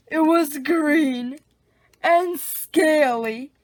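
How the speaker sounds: ordinary delivery, nothing marked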